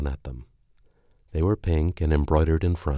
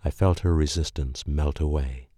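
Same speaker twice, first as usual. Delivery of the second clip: plain reading voice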